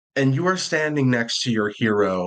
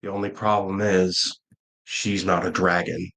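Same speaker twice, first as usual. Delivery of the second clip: put-on low, solemn old guardian confiding defeat; weary old guardian; 6 semitones lower than usual. Game master voices old guardian.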